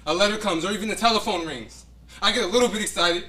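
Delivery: rising hope. Acted. Man telling his old love how he still hopes, rising eagerly. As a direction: let the hope lift your voice. Recalling hoping for her.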